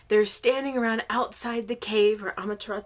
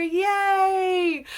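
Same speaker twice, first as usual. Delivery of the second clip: high, loud whoop of joy; glad cheer; 7 semitones higher, 7 dB louder than usual. A narrator's reading. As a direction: cheer as a whole welcoming crowd. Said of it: "everyone is so glad to see her".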